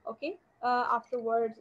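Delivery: ordinary talk, nothing marked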